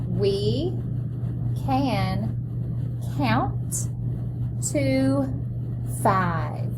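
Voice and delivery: each word set apart with pauses; word-by-word model reading. Shown as a demonstration. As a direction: read one word at a time for the class. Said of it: "Let's read that together".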